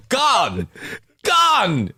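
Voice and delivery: put-on Jamaican accent. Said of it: "in a Jamaican accent".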